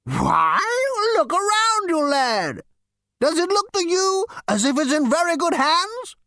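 put-on high, incredulous old ghost. A narrator's reading. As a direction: scold the lad in disbelief. Ghost continues.

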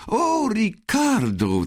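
very high, slow, sing-song coo of a woman; high fluttering cooing voice; chirping sweetly. Acted. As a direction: chirp his name like a delighted lover. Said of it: "zwitscherte sie".

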